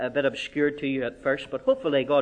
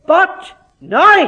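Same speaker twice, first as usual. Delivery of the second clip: "now" called out high and loud; triumphant proclamation; 12 semitones higher, 12 dB louder than usual. Acted. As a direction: proclaim "But now" like a verdict. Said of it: "this is his conclusion".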